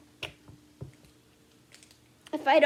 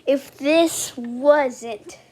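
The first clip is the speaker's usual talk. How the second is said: slow, loud, weighty delivery; loud, emphatic; 11 dB louder, slower than usual. Real, not acted. Collector imagining a first edition card, loud and emphatic. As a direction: hammer out each word slowly and loudly. Overwhelmed at card's possible value.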